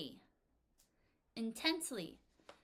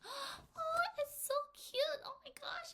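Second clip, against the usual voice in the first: very high, breathy adoring squeal; cooing over puppy; slower than usual. Acted. Someone stroking a cute puppy, high-pitched cooing. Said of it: "She cooed over the cute puppy".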